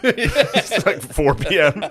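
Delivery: slow, drawn-out, laughing; laughing four P M. Real, not acted. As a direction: drag it out through a laugh. Laughing topping the joke.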